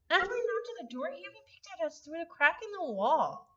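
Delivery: ordinary delivery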